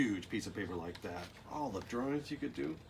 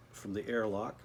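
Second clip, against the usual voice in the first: usual speaking voice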